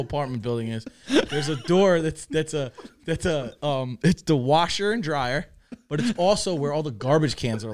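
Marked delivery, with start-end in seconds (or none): none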